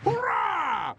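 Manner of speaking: high, loud hurrah; jubilant cheer. Acted. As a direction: burst out cheering. Cheers the new alliance.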